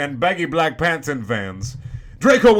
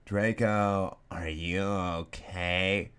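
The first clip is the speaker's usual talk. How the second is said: low, soft and slow, brooding goth; lower, softer, drawn-out gothic concern; 6 semitones lower, 8 dB quieter, much slower than usual. Acted. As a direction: sigh it out like a mopey goth. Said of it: "I asked in a gothic voice".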